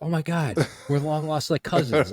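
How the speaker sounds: high, thrilled gush of a reunion; excited gush. Retold or imitated. Imagined tourist to a stranger, gushing excitedly. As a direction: squeal it like you found family. Imagined meeting long lost cousins.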